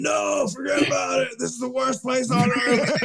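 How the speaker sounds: high, yelled mock despair. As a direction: cry out that it's the worst place ever. Mocking his Facebook post swing.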